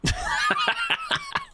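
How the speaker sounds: high burst of laughter. Real, not acted. Laughs after mock apology.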